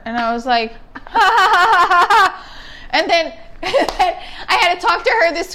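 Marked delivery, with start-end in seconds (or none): 1.0-2.5 loud, high staccato ha-ha laugh; acted laugh. Retelling her reaction to meeting the girlfriend, forced loud laugh. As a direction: force out a big mock laugh.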